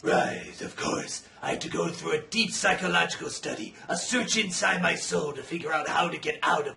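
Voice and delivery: low, rough, softened musing; solemn Batman. Acted. Bruce Wayne reflecting on his soul search, solemn rasp. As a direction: drop low for a self-important revelation.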